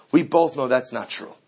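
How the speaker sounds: usual speaking voice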